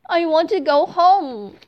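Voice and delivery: high, loud homesick wail; pleading through light tears; crying girl. Acted. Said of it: "Thumbelina cries".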